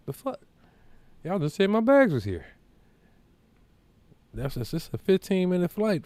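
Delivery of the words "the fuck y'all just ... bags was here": high-pitched indignant protest, acted out; retold disbelief. Retold protest about missing bags.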